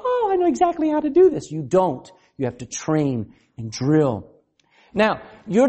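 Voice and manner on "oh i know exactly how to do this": high, bright mock confidence; a lightly voiced, squeaky know-it-all; sarcastic believer who expects magic dust, high; mock sudden know-it-all. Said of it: "you go".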